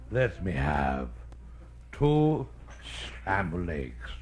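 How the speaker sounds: put-on very low, halting drunken order; low boozy mumble with long pauses; drunk diner customer. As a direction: order slowly, bombed. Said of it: "feeling no pain".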